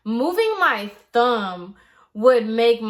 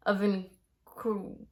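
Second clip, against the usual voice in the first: usual speaking voice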